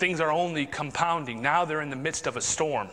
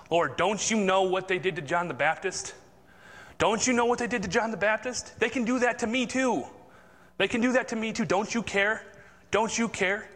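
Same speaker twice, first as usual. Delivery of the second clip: rising higher and higher in a plaintive whine; whiny pleading disciples; 6 semitones higher than usual. Acted. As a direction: beg like a frightened disciple who feels forgotten. Disciples pleading with Jesus, voiced.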